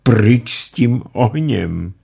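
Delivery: high, loud, slow crying plea; mother crying to stop the fire. Acted. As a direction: sob it out. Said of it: "řekla plačky".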